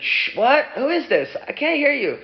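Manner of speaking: high-pitched mother on the phone; confused mother. Acted. Mother on phone can't hear caller, high confused voice.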